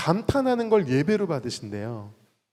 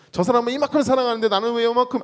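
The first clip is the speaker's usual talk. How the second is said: quick, high, louder whine; jealous complaint; 6 semitones higher, 4 dB louder, faster than usual. Retold or imitated. Voicing a jealous believer.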